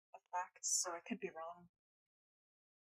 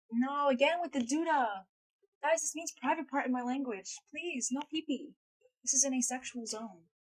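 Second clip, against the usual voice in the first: mock protest; 5 semitones higher, 6 dB louder than usual. Real, not acted. Mock protest at the doodah talk.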